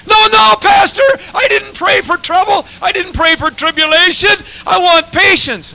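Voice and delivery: high, loud shouted objection; the lady yelling back in alarm; loud high "no, no" of protest; shocked protest. Retold or imitated. Lady protests pastor's prayer.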